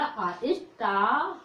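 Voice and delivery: आ then स्टार stretched very slowly; slow model word. Shown as a demonstration. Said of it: "ए को क्या बोला". (आ और स्टार को बहुत धीरे धीरे खींचकर)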